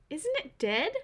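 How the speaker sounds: usual speaking voice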